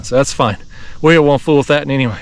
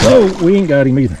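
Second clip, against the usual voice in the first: ordinary delivery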